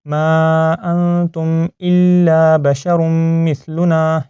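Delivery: slow, drawn-out Quranic recitation. Shown as a demonstration. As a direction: recite slowly with long held vowels. (ٹھہر ٹھہر کر تلاوت)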